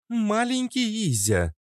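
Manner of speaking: sinister high hiss, slow; mustache twirling villain. Acted. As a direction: hiss it in an ear, menacing. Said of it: "зловеще прошипела на ухо".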